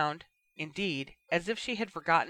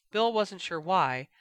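ordinary delivery

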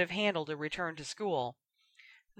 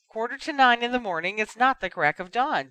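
interrupting; 5 semitones higher, 5 dB louder than usual. A narrator's reading. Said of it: "Ginny interrupted".

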